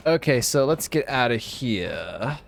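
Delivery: ordinary talk, nothing marked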